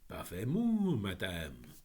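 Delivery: lightly voiced proud bird answering in French; a hint of a grand phoenix. A narrator's reading. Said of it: "replied in excellent French".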